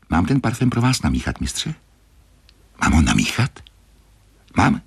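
pressing, half-whispered questions; eager creaky apprentice. A narrator's reading. Grenouille asks to mix the perfume, insistent and whispery. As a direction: urge the master in a strange, thin voice.

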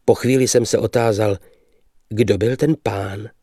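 plain reading voice

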